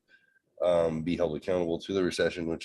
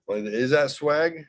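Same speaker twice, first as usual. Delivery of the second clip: each question climbs higher, ending in a squeaky laugh; rising mock questions; 5 semitones higher, 3 dB louder than usual. Dad mocking his daughters' slang, climbing ever higher. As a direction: push every slang word up until the voice cracks.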